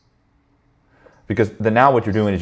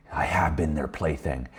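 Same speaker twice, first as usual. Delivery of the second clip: quiet admission; 2 semitones lower, 9 dB quieter than usual. Retold or imitated. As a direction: concede it softly and slowly, as the friend. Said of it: "friend admits it, quoted".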